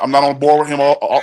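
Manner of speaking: ordinary talk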